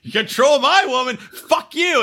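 high, mocking angry echo; bitchy mocking retort. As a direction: throw the words back in a high, bitchy voice. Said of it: "he starts going".

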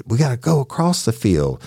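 just a touch of her attitude; lightly voiced woman. Deputy quoting her answer, lightly voiced attitude.

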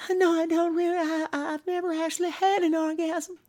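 very high, breathy falsetto giggle. Retold or imitated. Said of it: "sounds like [name]".

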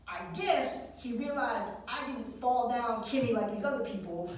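normal talking voice

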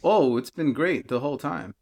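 ordinary delivery

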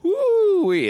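a high loud woo-wee; excited whoop. Real, not acted.

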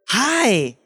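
high, over-cheery fast-food greeting; chirpy smiling employee. Retold or imitated. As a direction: greet with a put-on smile. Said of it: "they smile when they're like".

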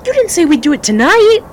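high, loud shocked outburst; shocked protest. Acted. Rookie protesting to his guide, shocked and loud. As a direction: blurt it out, caught off guard. Springing a surprise mission on him.

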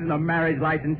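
normal talking voice

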